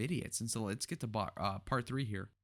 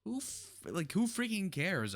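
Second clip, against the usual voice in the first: exasperated; 9 semitones higher than usual. Real, not acted. Mocking Kamala's sympathy.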